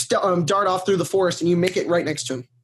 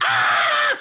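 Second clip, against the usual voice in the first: high panicked "Ah!"; creature's scream. Retold or imitated. Creature freaking out, high panicked shriek. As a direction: shriek like a terrified little creature. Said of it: "the creatures is just like".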